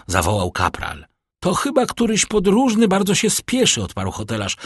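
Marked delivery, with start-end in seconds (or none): 1.4-3.8 high-pitched innkeeper's reply; high, lifted voice for the innkeeper; lightly acted innkeeper. A narrator's reading. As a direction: answer in a much higher character voice. Innkeeper answers the corporal.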